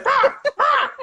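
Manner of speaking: put-on very high, loud baby babble; baby barking babble. Toddler barking at his crying older brother, very high and loud. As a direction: bark it out like a one-year-old. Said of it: "He just barks, really".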